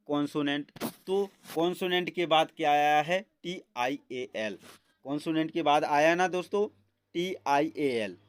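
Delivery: usual speaking voice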